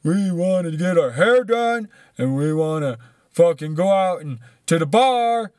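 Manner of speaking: loud, drawn-out mock whining of gun-toting protesters. American protesters demanding haircuts and bars, in a loud mocking voice. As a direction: ridicule them with slow, exaggerated bellowing. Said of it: "half the countries run around with guns and saying".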